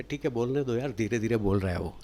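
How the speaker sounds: low, soft, indifferent aside; dismissive audience aside. Acted. Trainer voices a bored audience about a low-energy speaker, dismissive aside. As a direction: imitate listeners quietly writing off the speaker.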